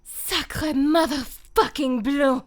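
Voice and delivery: loud, drawn-out French-style curse; theatrical, slow, loud outburst; Widowmaker impression. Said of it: "the Widowmaker, of course".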